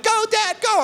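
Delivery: put-on high, sweet little girl cheer; very high sweet kid cheer; sweet cheering daughter. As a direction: cheer him on like a small girl. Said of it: "trying to cheer me on, super sweet".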